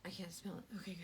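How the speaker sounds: faint whispered line. Real, not acted. Refusing to sniff the chai, whispered. Avoiding smell.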